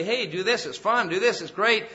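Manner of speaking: lightly acted friendly urging; eager coaxing. Worldly people coaxing a believer toward fun, eagerly.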